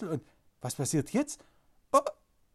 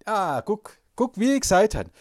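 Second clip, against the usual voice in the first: ordinary delivery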